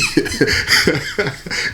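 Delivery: amused chuckle; laugh. Real, not acted. Storyteller owning up to his audience, amused laugh. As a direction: chuckle at your own confession.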